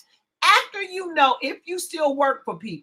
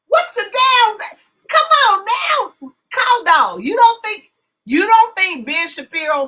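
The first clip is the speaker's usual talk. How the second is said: high, loud disbelief; loud protest; 9 semitones higher, 8 dB louder than usual. Real, not acted. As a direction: holler it in playful outrage. Protesting when listener won't agree.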